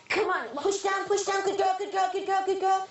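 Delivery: high-pitched, rapid-fire encouragement; urgent coaching. Real, not acted. Midwife urging a labouring woman to push, urgent coaching. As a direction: keep her pushing with insistent repeats.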